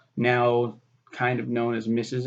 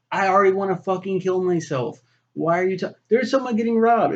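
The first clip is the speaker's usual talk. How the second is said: pretend rant; 4 semitones higher, 3 dB louder than usual. Ranting at boss, imagined self.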